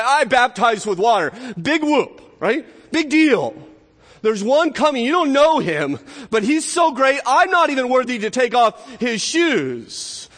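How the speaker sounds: high, forceful voice of an acted prophet; emphatic self-dismissal. John to his admirers about the Messiah, emphatic self-dismissal. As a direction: wave yourself off, then build up the one coming.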